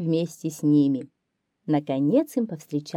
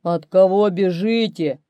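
louder growly bear call; growling bear; 6 dB louder than usual. A narrator's reading. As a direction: roar out the bear's question. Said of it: "чит". (рычащий медведь)

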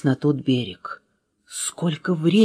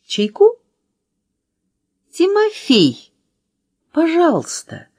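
hospitable hostess; 11 semitones higher than usual. A narrator's reading. Letter И calls Тимофей for tea, high and hospitable. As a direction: call out politely in a high voice.

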